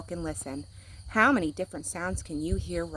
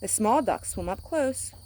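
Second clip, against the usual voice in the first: ordinary delivery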